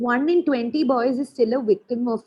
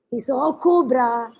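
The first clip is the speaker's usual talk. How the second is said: put-on louder, lively story voice for kids; storytelling to children; 3 semitones higher, 6 dB louder than usual. Shown as a demonstration. Teacher demoing the yoga story, lively storytelling. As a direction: tell it like a children's tale. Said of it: "You do the bridge".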